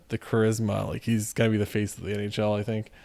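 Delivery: ordinary talk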